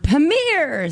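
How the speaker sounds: shouted. Shown as a demonstration. Co-host corrects how the name is said, shouted. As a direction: belt out Premier like it's all capitals. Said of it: "you have to shout it".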